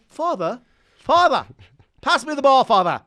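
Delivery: high, hammed-up English accent; old-fashioned English son calling out; mock English accent. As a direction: call across the court in a put-on English accent. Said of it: "The English accent, by the way, is needed".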